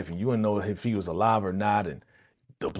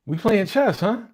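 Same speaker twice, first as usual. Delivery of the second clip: higher, louder playful tease; teasing; 10 semitones higher, 4 dB louder than usual.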